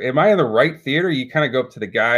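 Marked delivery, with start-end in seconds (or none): 0.0-1.1 bewildered. Retold or imitated. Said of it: "And you're kinda like".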